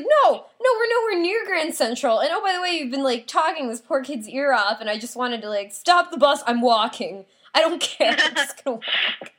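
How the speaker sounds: high, loud mock exasperation; exasperated outburst. Passenger fed up with a talker near Grand Central, exasperated retold outburst. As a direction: act out being fed up, pitched high.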